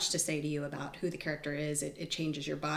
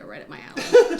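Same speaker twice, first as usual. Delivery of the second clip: laughing; 8 semitones higher, 14 dB louder than usual. Real, not acted. Joking about corsets.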